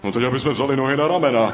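very fast, rushed answer; hurried. Acted. Bird Noach urging the escape, rushed and fast. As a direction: blurt the plan in a rush. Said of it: "řekl Pták Noach".

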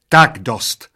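loud, angry cut-off; fed-up waitress yelling; sharp yell. A narrator's reading. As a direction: shout them to a stop. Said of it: "zaječela číšnice".

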